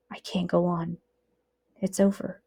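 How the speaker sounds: soft, worn out and hopeless; weakened prisoner giving up; despairing murmur. Acted. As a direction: let the words drain away.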